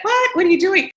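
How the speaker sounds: a very high yelp of disbelief; shocked family. Retold or imitated. Family hearing she is moving to Dubai, shocked high squeal. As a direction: play the stunned relative, pitch shooting up. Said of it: "My family was like".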